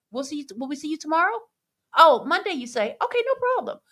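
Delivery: high, quick mock-pleasant boss; employer on phone.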